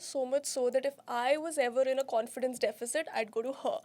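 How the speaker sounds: podcast host intro. Acted. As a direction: welcome the viewers in a host read. Host's podcast intro read.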